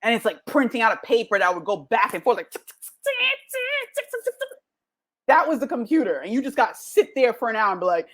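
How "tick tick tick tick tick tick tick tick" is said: sharp little ticks rising to high squeaks; imitated printer ticking. Speaker shows the crowd an old computer printing, imitated ticking. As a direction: mimic a noisy old printer with the mouth.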